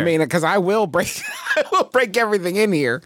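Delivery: threat breaking up into laughter; laughing. Real, not acted. Player threatening to break everything in here, laughing.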